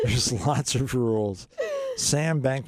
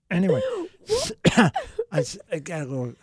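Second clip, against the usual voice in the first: coughing after swallowing wrong; coughing up water; 8 semitones higher, faster than usual. Real, not acted. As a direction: choke and cough on water mid-sentence. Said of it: "the water went down the wrong pipe".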